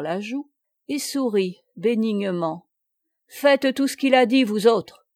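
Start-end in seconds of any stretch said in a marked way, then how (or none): 3.3-5.1 loud, higher exclamation to his men; cheerful order to the troops; exclaimed. A narrator's reading. Plélan tells his men to obey the boy, loud. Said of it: "s'écria-t-il".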